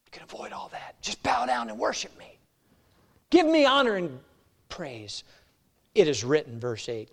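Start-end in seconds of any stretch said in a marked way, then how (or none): 0.1-5.2 a hint of a sly devil, dropping to a whisper; tempting devil. Preacher as the devil tempting Jesus, sly and hushed.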